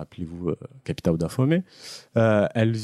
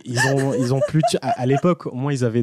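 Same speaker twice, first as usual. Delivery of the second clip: laughing backpedal; 4 semitones higher, faster than usual. Real, not acted. Backpedalling after the joke.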